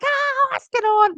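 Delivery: high, drawn-out mock alarm; panicked husband. Husband hears the fire alarm go off, mock panicked. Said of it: "My husband's like".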